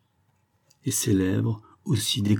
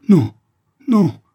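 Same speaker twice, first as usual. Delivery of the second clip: high, loud desperate plea; pleading; 9 semitones higher, 9 dB louder than usual. A narrator's reading. Dying lover begs his singer, pleading cry. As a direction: beg her not to pull away. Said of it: "supplia-t-il".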